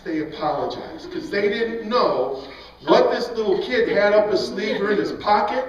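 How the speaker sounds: ordinary delivery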